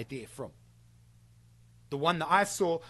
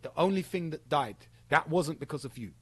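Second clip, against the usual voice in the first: ordinary delivery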